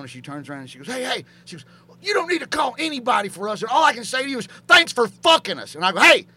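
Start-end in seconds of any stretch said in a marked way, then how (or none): 0.9-5.7 high-pitched, scolding retort; indignant woman imitation. Manager's wife turns on him, acted. 6.0-6.3 mock reprimand. Mock reprimand as comic comeback.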